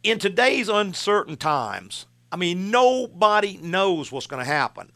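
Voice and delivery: ordinary talk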